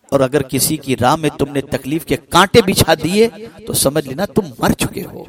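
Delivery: normal talking voice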